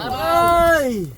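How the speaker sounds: high, loud startled "Ay"; surprised cry. Real, not acted. Sudden catch reaction.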